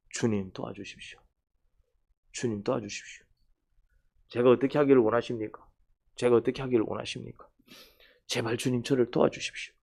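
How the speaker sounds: hushed pleading prayer to the Lord. Acted. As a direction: pray quietly and earnestly. Model prayer acted out.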